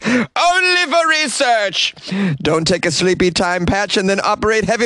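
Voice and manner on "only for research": mock falsetto. Mock repeat of his own line.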